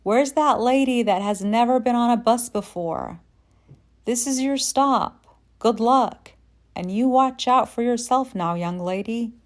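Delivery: loud, high call down the bus; yelling. Retold or imitated. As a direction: shout it from the front of the bus. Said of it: "I heard him yelling from the front of the bus".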